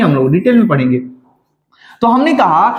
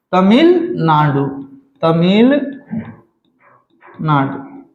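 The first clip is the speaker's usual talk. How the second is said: Tamil Nadu split as Tamil, Nadu, slower on each repeat; drawn-out dictation; 4 semitones lower, much slower than usual. Shown as a demonstration. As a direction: stretch Tamil and leave a growing gap before Nadu. Teacher repeats name ever slower.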